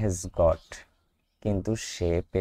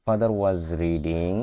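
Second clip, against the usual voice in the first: slow model reading; much slower than usual. Shown as a demonstration. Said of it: "teacher models sentence slowly".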